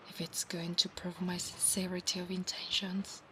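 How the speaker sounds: soft whispered storytelling; hushed whisper. Acted. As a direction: whisper the promise close to his ear. Whispered roleplay.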